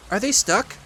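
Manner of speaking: high, mildly anxious little voice; anxious Piglet. Acted. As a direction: ask it high, a touch worried. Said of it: "asked Piglet anxiously".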